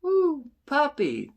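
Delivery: put-on high, slow little-girl coo; delighted little girl. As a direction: coo sweetly at a puppy in a child's voice. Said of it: "the little girl goes from".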